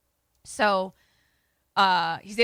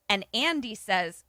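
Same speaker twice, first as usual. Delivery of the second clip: ordinary talk, nothing marked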